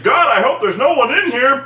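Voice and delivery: sarcastically voicing a careless Superman, high and loud; mock Superman. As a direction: toss it off with fake concern. Mocking Superman's indifference.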